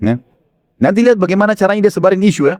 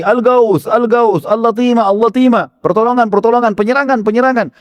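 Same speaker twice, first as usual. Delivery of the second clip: higher-pitched mock alarm, chanted in a run; imitated repeated shouting; 7 semitones higher than usual. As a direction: cry the alarm over and over, without full force. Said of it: "teriak lagi". (tiruan teriakan berulang bernada tinggi)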